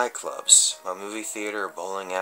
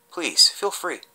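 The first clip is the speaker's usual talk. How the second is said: higher, playful please; mock sweet invitation; 9 semitones higher, 3 dB louder than usual. Vlogger daring viewers to check their photo albums, playfully higher. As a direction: dare them to go look.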